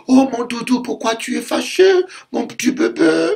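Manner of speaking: put-on high, soft baby talk; calm him down with cooing; a wife soothing her angry husband like a baby.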